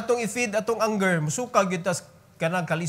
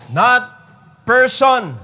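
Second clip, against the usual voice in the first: emphatic; 5 semitones higher, 9 dB louder, much slower than usual. Shown as a demonstration. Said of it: "emphatic sermon words".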